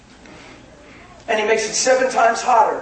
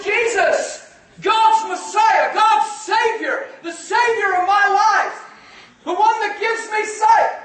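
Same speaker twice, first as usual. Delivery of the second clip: high, drawn-out impassioned cry; yelling louder; 11 semitones higher, slower than usual. Acted. Bartimaeus calling Jesus his Messiah, yelling louder. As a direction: cry out each title with mounting fervour. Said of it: "He yelled all the louder".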